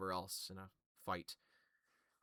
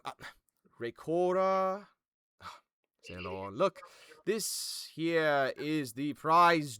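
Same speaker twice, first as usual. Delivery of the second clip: higher, louder, slow and showy; eager host; 7 semitones higher, 15 dB louder, slower than usual. Acted. Venthan shows off the prize to his council, gushing. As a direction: gush over the prize guest. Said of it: "Venthan's eyes light up and goes".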